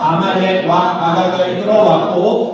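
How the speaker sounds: slow, measured scripture recitation; scripture reading. A narrator's reading. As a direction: read the verse out slowly, as if leading a congregation. Scripture read aloud in unison. (성경 봉독하듯 느리고 또박또박)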